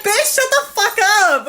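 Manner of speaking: high, loud, indignant retort; retold angry yell. Driver retells cursing at honker, loud mock fury. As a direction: cuss out the honker, hammed up.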